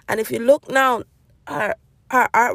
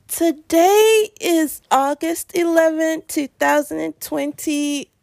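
slow, drawn-out morning announcement; sleepy drawl that drags out to the end; tired; 4 semitones higher, slower than usual. Real, not acted. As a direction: stretch each phrase lazily, pitched a little high. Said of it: "I'm so tired".

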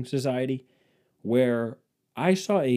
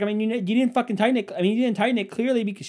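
higher, louder, fast whiny wife; wife explaining the fallen curtain rod, higher accusing tone; mock wife blaming; 10 semitones higher, 4 dB louder, faster than usual. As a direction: rattle off the blame in a higher voice. Wife accusing, acted quarrel.

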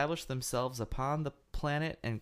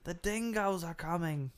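mock Australian; 5 semitones higher than usual.

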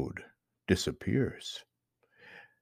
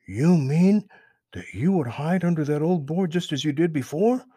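high, louder, wise-sounding rabbit; knowing Peter Rabbit; 7 semitones higher, 4 dB louder than usual. Acted. Peter questions Mister Toad, high and knowing. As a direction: pitch up and sound clever. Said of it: "said Peter with a very wise look".

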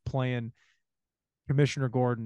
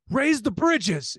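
drawn-out, high, loud command; shouted order; 9 semitones higher, 5 dB louder, much slower than usual. Retold or imitated. Gordon ordering the dirty cop, imitated urgent shout. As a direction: yell it slowly like an urgent order.

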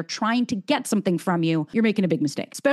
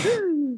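sharp gasp; 9 semitones higher than usual. Retold or imitated. Nervous reaction to a comment.

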